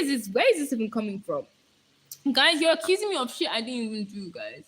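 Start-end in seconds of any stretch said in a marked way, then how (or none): none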